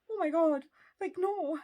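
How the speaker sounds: panicked rise to a high, loud "no"; squealing high and loud in alarm; panicked dragon. Retold or imitated. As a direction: voice the dragon freaking out. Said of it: "and the dragon's like".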